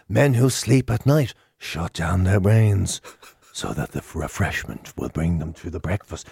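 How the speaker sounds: a hint of a lofty sage stating the obvious; mock Dalai Lama wisdom. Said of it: "who say it like it means something".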